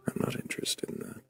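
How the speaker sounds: ordinary talk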